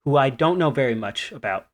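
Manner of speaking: ordinary delivery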